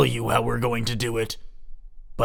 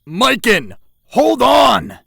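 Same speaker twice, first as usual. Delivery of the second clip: high, loud shout; desperate shout after a fleeing boy; 10 semitones higher, 11 dB louder, much slower than usual. Acted. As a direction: yell for him to stop. Calling after angry boy.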